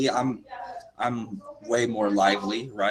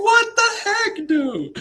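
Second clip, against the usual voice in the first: very high, slow, stretched What the heck; high-pitched drawn-out shock; mock disbelief; 6 dB louder, much slower than usual. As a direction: act stunned in a squeaky voice. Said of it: "I was like".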